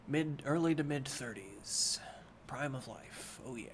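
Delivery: whispering to himself; a private whisper while reconsidering the text; whispered aside. Real, not acted. Reader muttering corrections to himself in a whisper. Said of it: "whispered aside about ages".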